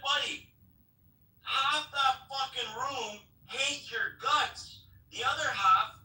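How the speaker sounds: high, heated telling-off; retold scolding. Speaker chewing out the inspector after the event, high heated scolding. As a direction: lay into him, incredulous and angry.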